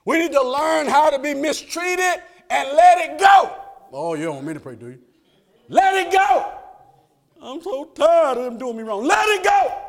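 loud, high preaching push, the congregation rising with him; fiery preaching. Acted. Preacher urging the church to let it go, full-force preaching. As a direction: drive it home like a revival preacher.

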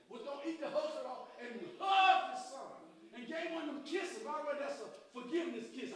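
loud, high-pitched rising sermon shout; full-throated gospel preaching; preaching at full force. Acted. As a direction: whip the congregation up with the message. Prodigal's return preached loudly.

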